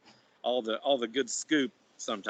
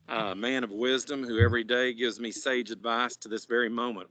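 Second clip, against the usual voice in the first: ordinary talk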